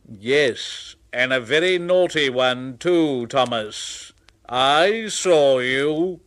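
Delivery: lightly voiced stern boss; a hint of a scolding official; stern fat controller. Fat controller scolding naughty Thomas, lightly voiced.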